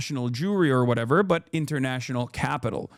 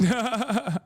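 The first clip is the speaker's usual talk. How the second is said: higher-pitched burst of laughter; short incredulous laugh; 7 semitones higher than usual. Real, not acted. Reacts to the played clip.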